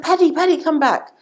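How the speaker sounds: high-pitched call after someone; calling him back. Acted. Nun calls Paddy back in joke.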